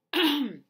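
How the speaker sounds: sharp painful cough; a cough that stings; coughing after surgery. Real, not acted. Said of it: "it hurts to cough a little".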